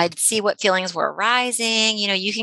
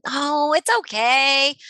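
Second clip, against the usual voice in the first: slow, higher, brushing-off oh it's okay; dismissive brush-off; 6 semitones higher, much slower than usual. Shown as a demonstration. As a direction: wave the feeling away. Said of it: "We're not telling them that".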